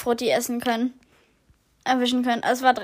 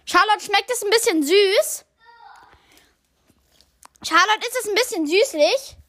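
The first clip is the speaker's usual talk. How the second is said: calling out; 8 semitones higher, 4 dB louder than usual. Real, not acted. Calling to friend in bathroom.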